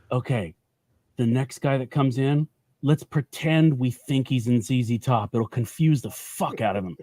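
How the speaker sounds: low, soft plotting among pretend store clerks; hushed, low prankster planning; mock conspiratorial. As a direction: drop your voice and scheme with coworkers.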